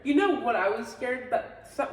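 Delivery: ordinary talk, nothing marked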